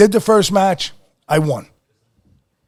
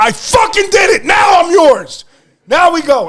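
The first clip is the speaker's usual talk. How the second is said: high, loud and thrilled; victory shout; 9 semitones higher, 4 dB louder than usual. Retold or imitated. Wrestler outside after winning, shouting excitedly. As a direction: shout it out to everyone who doubted.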